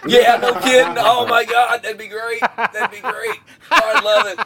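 high, laughing oh my God; laughing delight. Real, not acted. Friend cracking up at a teen quiz joke, laughing high. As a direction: laugh it out, thrilled by the idea.

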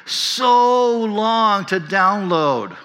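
"so long" said very slowly and high; stretched so long. Retold or imitated. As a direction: draw out "so long" like a whine. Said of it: "they emphasize the S O O O O".

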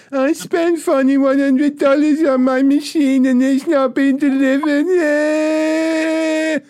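high, slow, whining mimic of the caller; mocking whiny customer. Call-centre worker mocking a caller's whine, high and slow. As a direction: squeak it out like a spoiled complainer. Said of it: "you're like".

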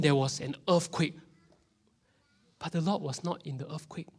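ordinary talk

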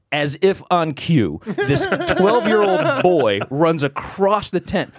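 usual speaking voice

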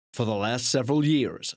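hammed-up American blurb voice; dramatic American accent. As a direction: put on a dramatic, badly faked American accent. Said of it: "dramatic voices are always American as well".

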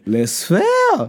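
high-pitched, disbelieving question; genuinely taken aback, voice jumping up; incredulous echo. Real, not acted. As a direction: throw the name back in disbelief. Disbelief that friend doesn't know them.

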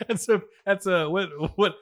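ordinary talk, nothing marked

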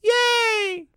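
put-on very high squeaky child's yay; kid cheer. Imitating Rod and Todd for his cohost, high squeal. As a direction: shriek it like a little boy. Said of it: "trying to get the cadence of, of Rod and Todd".

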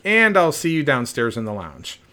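acted loud comeback to a goodbye; coach calling after athlete. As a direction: call it out to stop someone leaving. Coach calling athlete back for confrontation.

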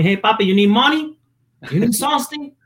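put-on high Puerto Rican dad accent, questions lilting up; dad's Spanish accent. Son mimics his dad offering money, high Spanish accent. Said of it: "I don't know why he has an accent".

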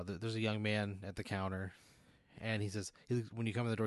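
ordinary talk

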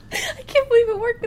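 high helpless giggling; cry-laughing, can't stop; hiccup giggles. Real, not acted. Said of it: "I made her laugh for forty minutes".

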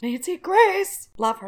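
adoring fan saying her favourite host's name; gushing. Real, not acted. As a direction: swoon over the name like a superfan. Gushing over a TV host.

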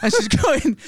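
usual speaking voice

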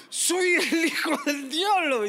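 put-on menacing boy. Boy declares himself devil's son.

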